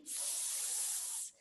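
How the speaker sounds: "s" stretched into a long hiss; isolated S sound. Shown as a demonstration. Teacher repeating the soft C sound, long "s". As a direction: sustain a voiceless "s". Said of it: "é o som do S".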